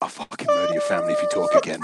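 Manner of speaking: hammed-up exasperated threat; mock threat. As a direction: threaten the interrupting co-host in jest. Mock threat at interrupting co-host.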